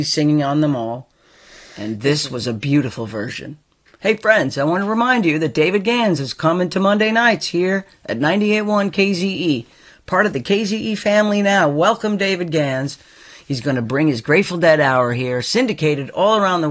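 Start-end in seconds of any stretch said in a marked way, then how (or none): 4.0-13.0 radio promo voice. Acted. Upbeat radio promo read.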